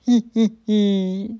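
put-on slow, sly old wolf chuckle. A narrator's reading. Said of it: "s'esclaffe le vieux loup".

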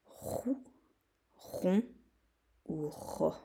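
roux, rond, ro read as separate choices; syllables offered. Shown as a demonstration. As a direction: lay out roux, rond, ro one by one. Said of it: "trois syllabes". (roux, rond, ro détachés)

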